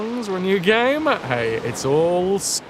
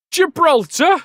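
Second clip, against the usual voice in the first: much higher, louder incredulous echo; mock disbelief; 14 semitones higher, 8 dB louder than usual. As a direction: squeak it in disbelief.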